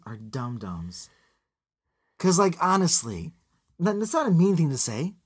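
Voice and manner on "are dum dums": dum-dums said slow, low and soft; slow quiet low. Shown as a demonstration. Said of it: "That's gonna be on your quote".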